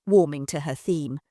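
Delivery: plain reading voice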